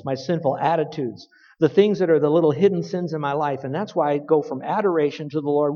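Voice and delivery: ordinary delivery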